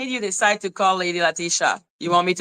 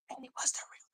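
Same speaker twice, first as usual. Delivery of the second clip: breathy hushed reveal; dramatic whisper; 21 dB quieter, much faster than usual. Real, not acted. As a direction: lean in and whisper the secret.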